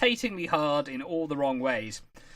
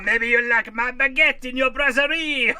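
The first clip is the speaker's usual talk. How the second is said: put-on high laughing French accent; 8 semitones higher, 7 dB louder than usual. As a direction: giggle through a French caricature. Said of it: "that's actually how he sounds".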